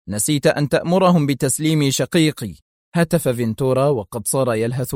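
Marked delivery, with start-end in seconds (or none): none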